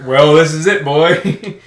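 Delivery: loud, rising drill sergeant taunt; booming drill instructor. Retold or imitated. Drill instructor greeting, retold.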